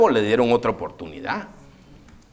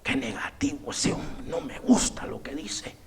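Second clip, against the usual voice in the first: softer, lower whispered complaint; King Ahab's grumble; 3 semitones lower, 10 dB quieter than usual. Retold or imitated. King Ahab sulking about the prophet, hushed whisper. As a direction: mutter it under your breath like a sulking king. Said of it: "Y así decía ese rey, era Acab".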